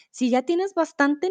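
usual speaking voice

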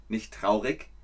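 normal talking voice

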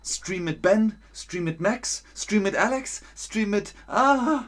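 high, dithering list with rising ends; acted decision paralysis. Teacher acting an overwhelmed viewer choosing streams, high and flustered. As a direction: waver between options, ending in a high wail. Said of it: "Oder hast du Entscheidungsparalyse?".

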